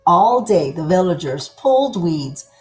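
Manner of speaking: plain reading voice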